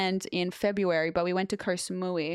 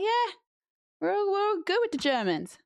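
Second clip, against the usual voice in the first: mock Poles; 11 semitones higher than usual.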